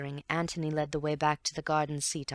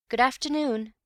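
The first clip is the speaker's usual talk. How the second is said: put-on young Bill, pitched higher and bright-edged; Bill chimes in, light and higher; Bill's goodbye; 9 semitones higher, 5 dB louder than usual. Bill says goodbye to the inspector, voiced higher. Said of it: "said Bill".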